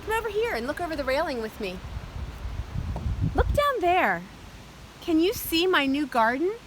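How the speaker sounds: put-on bright, high children's host voice; sing-song welcome for little kids; overbright kids party host. Kids show host greeting.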